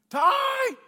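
put-on high-pitched loud holler for a kid; mom's far-carrying neighborhood yell. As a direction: holler his name down the street like mom. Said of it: "yelled at me".

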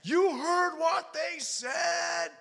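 high, slow, loud shout uphill; yelled back up. Retold or imitated. Father yelling up the hill at his son, slow high shout. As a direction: shout the question up at him. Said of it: "I yelled back up".

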